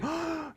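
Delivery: sharp mock gasp of surprise; amazed gasp. Teacher acts entering a big room, astonished gasp. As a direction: suck in air as if astonished.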